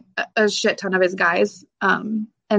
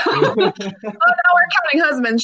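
laughing aside; 8 semitones higher, slower than usual. Real, not acted. Laughing at her own joke.

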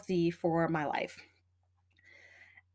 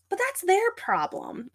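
dismissive; 9 semitones higher than usual. Retold or imitated. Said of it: "And I'm like".

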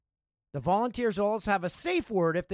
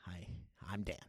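very low, hushed self-introduction; near-whispered deadpan correction; muttered aside; 11 semitones lower, 16 dB quieter than usual. Real, not acted. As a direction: murmur it under your breath, deadpan. Sheepish aside on reader's mistake.

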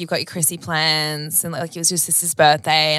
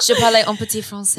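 French phrase; 4 semitones higher than usual. Shown as a demonstration. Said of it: "I have to teach you a little bit of French".

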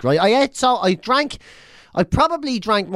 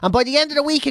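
exasperated; 10 semitones higher than usual. Real, not acted. Exasperated about the gas.

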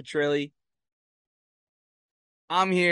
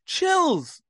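high awed exclaim; 12 semitones higher than usual. Real, not acted. Awe at the moment.

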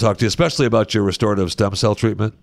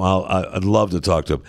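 ordinary talk

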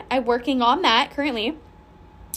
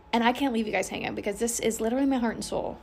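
normal talking voice

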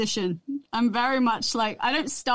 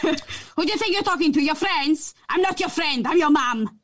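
higher, scolding voice in a Portuguese mum's accent; 4 semitones higher than usual. Shown as a demonstration. Mum telling off her child, scolding Portuguese accent. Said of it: "Do it one more time".

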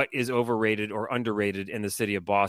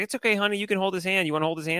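higher, gentle coaxing; soothing dad; 7 semitones higher, much faster than usual. Retold or imitated. Dad calming his daughter on the escalator, gentle higher coaxing. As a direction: ease a scared little girl onto a stranger's hand. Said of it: "I was like".

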